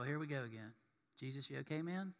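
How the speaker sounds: soft, low, awkward check-in; mock under-the-breath concern; wary aside. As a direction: drop it down, uneasy. Disciples' wary aside, acted.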